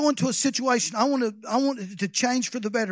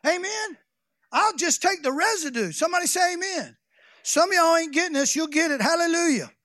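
higher, louder preaching climax; preaching at full force; 5 semitones higher, 4 dB louder than usual. Real, not acted. Preacher exhorting congregation to be the pipe, shouting. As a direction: build it up and shout it out.

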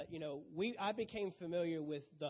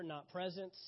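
ordinary delivery

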